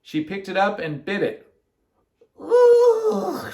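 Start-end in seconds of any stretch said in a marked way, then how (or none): 2.4-3.5 high, loud, drawn-out scream. A narrator's reading. Miss Ralph bites the chocolate, screaming. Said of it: "she screamed".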